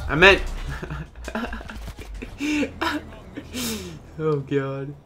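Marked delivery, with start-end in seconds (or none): 0.5-4.1 long, high, quiet laugh; helpless giggle at your own bad joke; drawn-out high giggle. Real, not acted.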